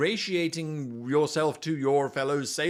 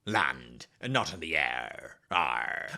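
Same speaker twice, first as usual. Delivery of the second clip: put-on low, slow old sea captain; pirate voice; 8 semitones lower than usual. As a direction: growl it like a salty pirate captain. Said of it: "I just like doing the pirate voice".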